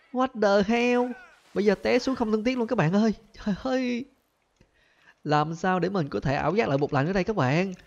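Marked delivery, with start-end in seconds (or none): 0.1-4.0 much higher, exasperated voice; high dismayed cry. Real, not acted. Gamer wails high in dismay as he falls to the ground. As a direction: wail in disbelief at the fall. Falling after hallucination ends.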